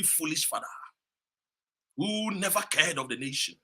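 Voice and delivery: normal talking voice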